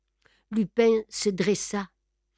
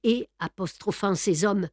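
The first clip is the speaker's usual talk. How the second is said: plain reading voice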